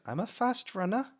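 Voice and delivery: put-on high, proud little boy; a lightly voiced grinning boy; eager child. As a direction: brag like a keen kid. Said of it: "grins up at you, Taryn, and goes".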